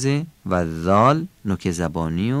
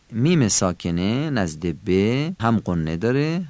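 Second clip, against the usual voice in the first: normal talking voice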